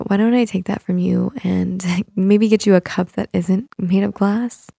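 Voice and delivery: ordinary talk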